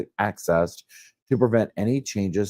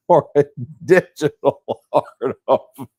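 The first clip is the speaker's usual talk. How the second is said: cracking up mid-sentence, high and loud; breaking into laughter; 7 semitones higher, 7 dB louder than usual. Real, not acted. Reader reporting on the trial while the dog acts up, laughing. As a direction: lose the reading to helpless laughter. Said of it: "I'm laughing".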